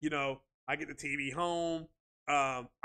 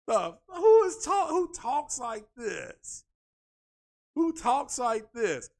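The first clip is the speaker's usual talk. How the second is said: high, loud, drawn-out disbelief; incredulous; 6 semitones higher, 5 dB louder, slower than usual. Real, not acted. Podcaster reacting to absurd console talk, incredulous. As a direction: stretch the question out in amused disbelief. Incredulous at how someone talks.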